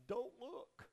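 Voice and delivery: high, urgent mock warning cry; hammed-up high-pitched warning. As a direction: warn them off like a film character. Retelling Raiders of the Lost Ark.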